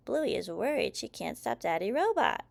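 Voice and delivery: usual speaking voice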